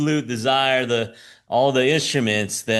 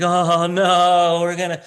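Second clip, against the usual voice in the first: mock fretting; 6 semitones higher than usual. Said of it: "They're like".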